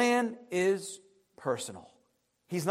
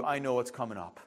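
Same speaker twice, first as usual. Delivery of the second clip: God's gentle voice; 9 semitones lower, 7 dB quieter than usual. Acted. God speaks gently to his people.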